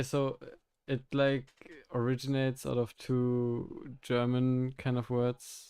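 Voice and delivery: usual speaking voice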